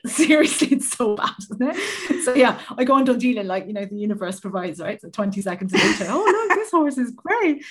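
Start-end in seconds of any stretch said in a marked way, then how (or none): 6.1-7.6 mock excited delight. Excited find on Done Deal.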